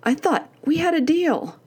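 retold indignation, pitched high; indignant. Mom's thought after daughter's disrespect, indignant. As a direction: relive the affront, pitch jumping up. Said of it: "The blatant disrespect went through me".

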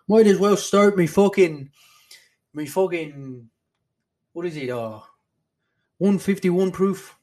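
loud, high, hammed-up Irish brogue; Conor McGregor Irish accent. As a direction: belt it out in a mock Irish accent.

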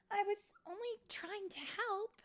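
high, pleading self-defence; hurt protest. Acted. Tink to Vidia who brushed her off, high hurt protest. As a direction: protest your good intentions.

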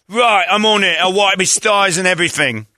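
high, eager British rocker voice; Jagger. Acted. Orderly Jagger answering a cleanup call, high eager rocker voice. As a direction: jump to it in a high, keen voice. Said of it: "Orderly Jagger".

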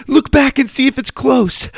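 very high, loud shout; panicked order. Acted. Susie yelling at her brother to look back, high loud panic. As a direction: shout the order mid-run. Fleeing kangaroo.

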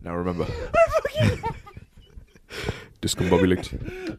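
laughing British mock word; British Batman. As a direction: say it like a stuffy British gent. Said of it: "in a British accent".

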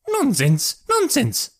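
high-pitched, emphatic mother, climbing on the repeat; emphatic exclamation. A narrator's reading. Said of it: "that emphatic exclamation".

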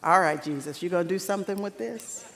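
low, soft, sceptical disciples' aside; doubtful disciples. Retold or imitated. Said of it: "So they pulled it out".

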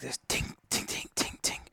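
soft, whispered tink tink of metal; imitated tinking. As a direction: mimic a faint metallic tapping under the breath. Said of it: "I keep hearing".

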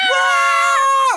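high excited scream from a crowd of women; loud high squeal of excitement. Retold or imitated. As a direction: shriek like a hen party losing it. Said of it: "like that, right?".

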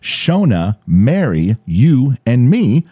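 "Shona, Mary, you, and me" spaced out, each item separate; slowed down. Retold or imitated. Host finally getting who's in the text, slowed-down list. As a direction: pick out each name slowly and firmly. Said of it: "So he slows it down".

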